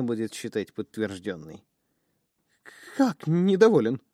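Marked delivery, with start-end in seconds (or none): none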